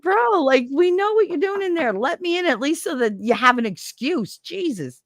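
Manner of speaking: high-pitched mock protest; exasperated plea, re-enacted; retold exasperation.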